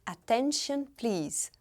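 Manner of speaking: attention, please dito à inglesa, "atenshon"; English pronunciation. Shown as a demonstration. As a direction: attention com final "shon". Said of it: "Em inglês se diz". (pronúncia inglesa de attention, please)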